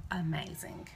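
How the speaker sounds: ordinary talk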